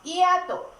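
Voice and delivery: "hiato" said as /iato/, with no sound for the H; silent h. Shown as a demonstration. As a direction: start "hiato" right on the i, the h silent. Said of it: "Tú dices".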